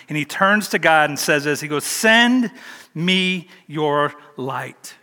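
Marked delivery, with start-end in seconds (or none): 1.8-4.9 very slow, drawn out prayer; prayerful psalm quote. Acted. Pastor voicing David's plea to God, very slow. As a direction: stretch every word toward heaven. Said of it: "quoting the psalm slowly".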